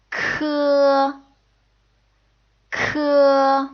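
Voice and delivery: K with a strong puff of air; K as a heavily aspirated sound; strongly aspirated k. Shown as a demonstration. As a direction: blow air hard on K. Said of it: "it's actually strongly aspirated".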